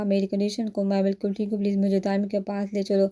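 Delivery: plain reading voice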